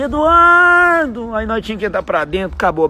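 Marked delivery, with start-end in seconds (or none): 0.0-1.3 slow, high drawn-out call; calling from afar. Retold or imitated. Mother calling her son home from far off, slow and high. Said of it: "Aí quando veio do, lá de longe".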